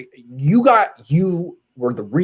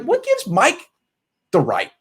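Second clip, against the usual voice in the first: imitating an indignant teammate; 8 semitones higher than usual. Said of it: "I'm sure he's sitting there like".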